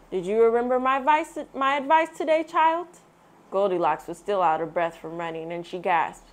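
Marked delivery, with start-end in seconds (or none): 0.1-2.9 lightly voiced father. A narrator's reading. Father asks.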